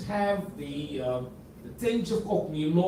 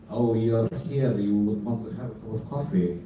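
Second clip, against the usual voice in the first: mock Russian accent; 7 semitones lower than usual. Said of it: "In fact, they say".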